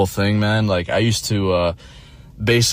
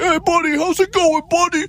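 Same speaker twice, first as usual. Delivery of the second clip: high falsetto mock boomer; hammed-up cheery old guy; boomer impression. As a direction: ape the overfriendly stranger up high. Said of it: "He'll be like".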